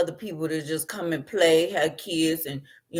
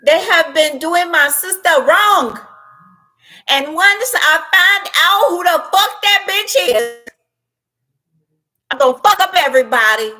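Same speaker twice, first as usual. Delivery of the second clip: high, loud enraged yell; Trinidad man yelling; 11 semitones higher, 8 dB louder than usual. Retold or imitated. Trinidad man furious about his sister, high loud yelling.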